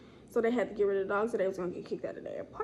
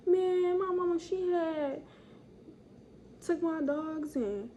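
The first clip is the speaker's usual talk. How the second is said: a higher voice for his excuse; imitating him; 6 semitones higher, slower than usual. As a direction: mimic the guy talking, light and hammed. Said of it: "let me stop talking like that".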